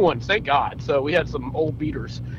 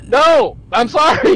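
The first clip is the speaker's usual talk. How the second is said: mock driver refusal; 11 semitones higher, 7 dB louder, slower than usual.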